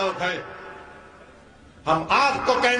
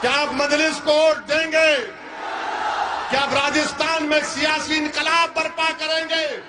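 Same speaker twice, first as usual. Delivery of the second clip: high, shouted questions to the crowd; ringing rally-cry, pitched up; rallying call; 5 semitones higher than usual. Real, not acted. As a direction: whip up the crowd for a roaring answer. Rallying crowd for louder response.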